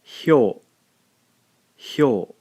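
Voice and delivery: hyo said as one glide; sound hyo. Shown as a demonstration. Teacher modelling the blended sound hyo. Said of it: "gives us the sound".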